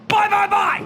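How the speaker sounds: very high, loud buy buy buy; catchphrase shout. Acted. Host rallies viewers to buy, shouting high and loud. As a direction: bellow the rallying cry like a game-show host.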